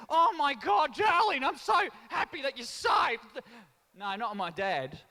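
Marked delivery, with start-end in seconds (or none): 0.1-3.2 high, loud gushing relief; relieved Aussie parent. Retold or imitated. Aussie parent overjoyed to find lost child. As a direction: gush over the found child. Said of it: "just as Aussie parents would do".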